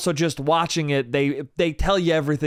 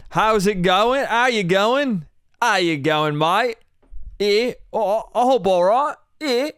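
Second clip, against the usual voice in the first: higher, hammed-up Australian "how you going, mate"; mock Australian accent; 5 semitones higher than usual. Podcaster greeting listeners in a put-on Australian accent. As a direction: lay on a cheesy Australian twang. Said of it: "Why am I speaking in a different accent".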